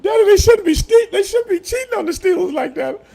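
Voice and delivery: put-on high, loud child's voice, outraged; little girl's shout. Imitating his little daughter yelling from the stairs, high and loud. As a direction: squeal it like an indignant little girl. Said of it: "my daughter would go".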